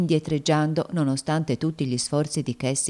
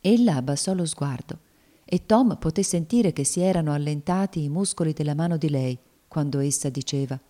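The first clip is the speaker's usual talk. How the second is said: plain reading voice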